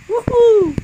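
loud high woohoo; excited whoop. Real, not acted. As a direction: whoop with joy in the water. Splashing into the fountain.

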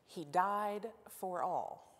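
each word weighed, slow, falling; slow emphatic claim. Acted. Pastor driving home Paul's claim, slow and emphatic. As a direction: let it land like a verdict. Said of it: "repeats the claim for emphasis".

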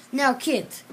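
loud, firm warning; stern warning. Acted. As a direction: warn the kids firmly. Teacher warns the children.

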